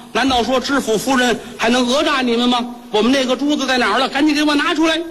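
high, fast indignant scolding; angry demand. Acted. Wife demanding the shopkeepers return her pearl, indignant high scolding. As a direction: demand it back, pitch rising.

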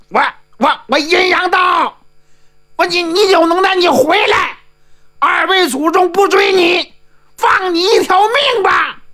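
very high, stammering call at the start, then sustained yelling; shouted taunt. A narrator's reading. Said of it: "阴阳道听到喊声".

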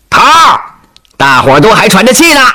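high, called-out cheeky answer; cheeky reply. Acted. Unseen soldier answering the commander, cheeky high shout. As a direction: pipe up playfully to the boss. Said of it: "传来这么一声俏皮的回答".

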